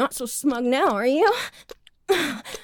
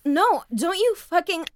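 ordinary talk, nothing marked

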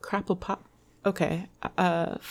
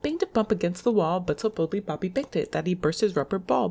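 usual speaking voice

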